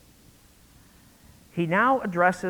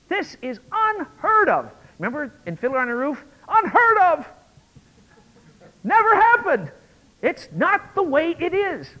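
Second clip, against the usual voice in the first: outraged cry; 12 semitones higher, 8 dB louder than usual. Retold or imitated. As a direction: cry it out as scandalised Pharisees. Outraged Pharisees, Fiddler quote.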